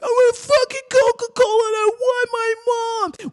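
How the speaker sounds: very high, hammed-up wailing shout; tantrum yell. Gunman demanding his mom from police, mock tantrum. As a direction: bawl it like an overgrown child.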